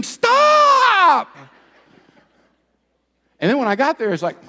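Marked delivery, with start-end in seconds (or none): 0.0-1.3 high, drawn-out comic scream. Acted. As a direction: scream it out at full volume. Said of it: "my body is screaming".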